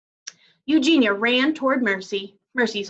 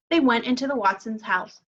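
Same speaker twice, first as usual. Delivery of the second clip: ordinary delivery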